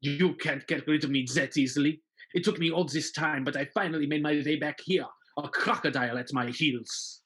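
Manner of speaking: sneering villain, smooth and menacing; scheming villain. Pretend. Von Turpin threatening Marius, in a sneering villain voice. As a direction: gloat over the hero's secret.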